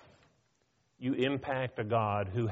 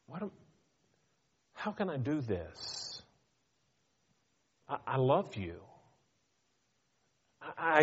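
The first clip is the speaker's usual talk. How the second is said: tender God. Retold or imitated. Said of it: "And he says".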